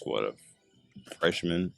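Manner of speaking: ordinary delivery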